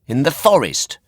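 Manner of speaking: lightly voiced lying puppet. Pinocchio lies again.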